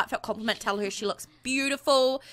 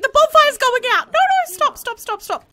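playful panic; 12 semitones higher, 7 dB louder than usual. Real, not acted. Panicking as fire goes out.

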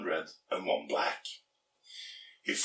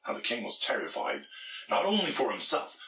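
ordinary delivery, nothing marked

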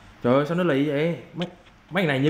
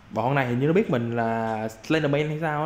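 usual speaking voice

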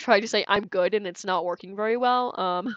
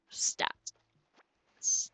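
mock TV doctor; 7 dB quieter than usual. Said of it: "I don't know why I said it like that".

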